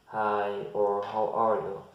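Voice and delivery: ordinary talk, nothing marked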